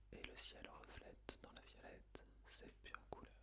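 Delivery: barely audible whisper; whispered echo. Shown as a demonstration. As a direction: whisper the verse as a prompt for pupils. Whispered echo in pupils' turn.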